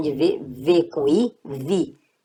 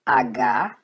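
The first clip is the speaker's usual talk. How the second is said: usual speaking voice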